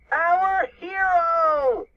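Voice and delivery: high, loud, slow cheer; adoring shout. A narrator's reading. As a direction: stretch it into a high triumphant shout. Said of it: "the boys shouted".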